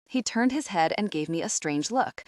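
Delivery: ordinary delivery, nothing marked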